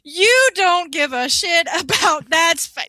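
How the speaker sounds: loud laughing aside. Real, not acted. Telling her friend the story: loud laughing. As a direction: laugh it out, can't stop.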